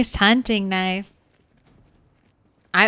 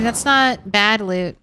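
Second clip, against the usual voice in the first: normal talking voice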